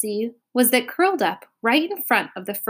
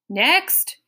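higher, crisp official call; official announcement; 6 semitones higher than usual. A narrator's reading. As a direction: announce it like a clerk. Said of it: "announced very officially".

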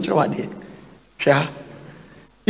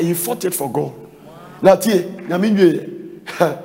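normal talking voice

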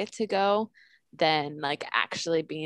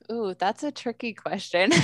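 normal talking voice

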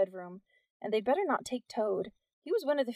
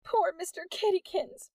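high, whiny lament for the cat; moaning; 10 semitones higher than usual. A narrator's reading. Peg lamenting her lost cat, moaning high. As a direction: moan it pitifully. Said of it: "Peg moaned".

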